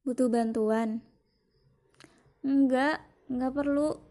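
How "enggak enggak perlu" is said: higher and louder hasty no; quick refusal. Acted. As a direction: wave off the help, louder. Refusing offered help. (menolak cepat, lebih keras)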